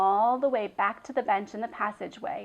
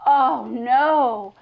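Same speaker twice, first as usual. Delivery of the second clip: loud, high dismayed gasp; shocked cry; 3 semitones higher, 12 dB louder than usual. Acted. Girl sees the goat.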